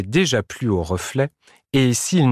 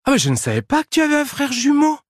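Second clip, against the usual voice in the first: very high, rapid exclamation; surprised cry; faster than usual. A narrator's reading. Badger discovers Prosper's supposed twin, cries out surprised. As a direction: blurt it out in amazement, high and fast. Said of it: "s'écria le blaireau".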